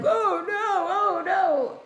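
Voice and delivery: high, hammed-up oh no; retelling the fat guy's silly death cry; mock dying groan. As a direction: ham up a dying man's lament. Dying fat guy retold.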